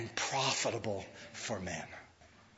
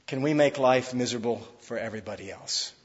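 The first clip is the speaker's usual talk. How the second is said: normal talking voice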